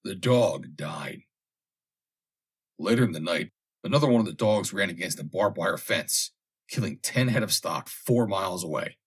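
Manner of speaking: plain reading voice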